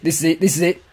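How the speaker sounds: ordinary talk, nothing marked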